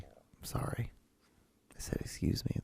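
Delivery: whispered apology, barely voiced; embarrassed whisper. Real, not acted. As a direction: whisper it as if caught burping. Apologizing after a burp.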